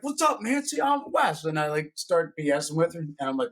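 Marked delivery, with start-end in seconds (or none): 0.0-1.4 much higher, bright coach hello; cheery high greeting. Retold or imitated. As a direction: greet her like a hyped-up friend.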